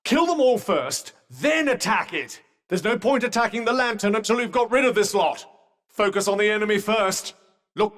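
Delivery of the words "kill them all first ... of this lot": high, insistent combat instructions; urgent battle coaching. Acted. Companion directing Frey to smash the lamp, urgent. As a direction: press the point urgently over a fight. Urging companion during a fight.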